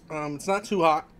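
normal talking voice

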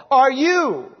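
"you" leaned on with weight; stress on you. Shown as a demonstration. Preacher stressing the word you to his congregation. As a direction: press hard on "you" in "Are you". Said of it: "you" is emphatic".

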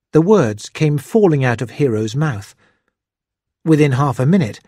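plain reading voice

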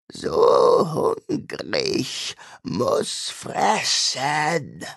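very slow, breathy, hungry creature rasp; hungry demon rasp. A narrator's reading. The demon craves Joan's memories, slow ravenous rasp. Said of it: "Die Stimme klang kratzig".

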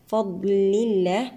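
"الله" with a thin lam after kasra; light lam. Shown as a demonstration. Tajweed teacher showing tarqiq, thin lam in "فضل الله". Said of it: "لام لفظ الجلالة هنا مرقق سبقه كسر".

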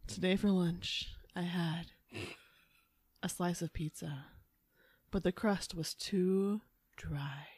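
slow, soft, breathy seductive purr; boring words made sultry; sexy phone voice. Pretend. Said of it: "worst sex phone operator, I would be like".

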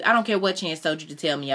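ordinary delivery, nothing marked

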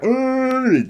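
high drawn-out creature roar; Chewbacca. Retold or imitated. Retelling Chewbacca in the firefight, imitated Wookiee roar. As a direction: imitate a long Wookiee growl. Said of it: "Chewbacca's like".

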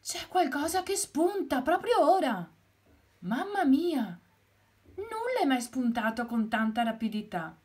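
high loud excited rooster; excited amazement. Acted. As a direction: cry out as the ground cracks. Something sprouts before rooster.

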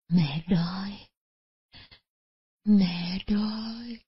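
faint, slow starving whisper; weak whisper. A narrator's reading. Bedridden mother whispering to her daughters, faint and slow. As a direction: breathe it out barely audible. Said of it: "mới nghe bà thều thào".

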